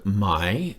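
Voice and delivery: "my" gliding upward; rising tone. Shown as a demonstration. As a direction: lift "my" like a question word. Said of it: "with a rising tone".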